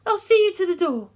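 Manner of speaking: put-on high, pleading young woman's voice; lifted female voice, anxious and imploring. A narrator's reading. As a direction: voice a distraught heiress begging for help. Darlington sees him out.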